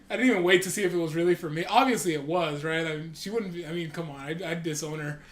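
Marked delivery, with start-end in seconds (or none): none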